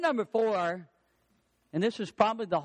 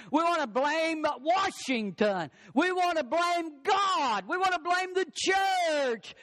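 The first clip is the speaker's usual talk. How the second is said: building emphasis; 9 semitones higher, slower than usual. Real, not acted. Building list of who we blame.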